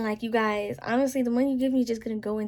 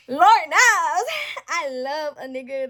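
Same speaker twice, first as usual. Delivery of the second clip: excited exclamation; 5 semitones higher, 7 dB louder, slower than usual. Real, not acted. Gushing over men with manners.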